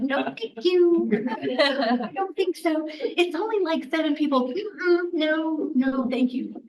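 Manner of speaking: playfully prissy decline; mock prim refusal. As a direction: introvert turning down a class with people, high and prim. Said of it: "And I will be like".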